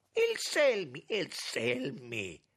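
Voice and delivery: loud roar, high then drawn out. A narrator's reading. Minister clenching fists at his enemy's name, roaring. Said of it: "ruggì Francesco Datri".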